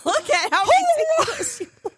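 high squealing laughter through the words; laughing shout at how silly it looks; laughing hard. Real, not acted. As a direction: crack up while you say it. Laughing while telling listeners.

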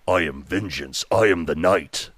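low, brooding superhero voice; Kevin Conroy Batman. Shown as a demonstration. Auditioning for a Batman video, brooding superhero voice. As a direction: intone it low and slow, a dark vigilante. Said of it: "doing more the Kevin Conroy Batman".

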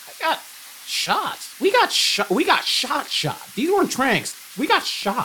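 high, insistent disbelief; incredulous. Real, not acted. Player insisting to the other they got shot, high and incredulous. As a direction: keep insisting, pitch climbing.

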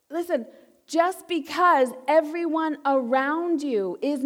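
slow and deliberate, every word weighed; slow pulpit emphasis; slow, emphatic. Real, not acted. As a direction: drag it out so it lands. Preacher slowing for emphasis.

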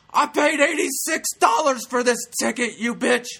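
high, loud mock-outraged yelling; imitated angry shout. Guy in the audience yelling at the heckler, angry imitation. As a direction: bellow it like a cheated ticket holder. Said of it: "There's a guy next to me".

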